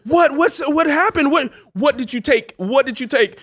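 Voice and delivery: high, loud frantic questions; alarmed mom. Retold or imitated. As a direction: play the panicked mother grilling her son. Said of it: "my mom's just like".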